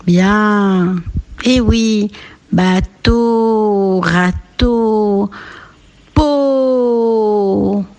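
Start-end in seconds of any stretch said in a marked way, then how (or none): 2.5-7.8 bateau, râteau, peau, each final O held long; final O stretched. Shown as a demonstration. Teacher lists rhymes, bateau, râteau, peau with long endings. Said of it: "où on entend bien le dernier son".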